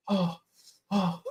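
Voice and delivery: imitating panting for breath; mock breathless gasping. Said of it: "Are you out of breath?".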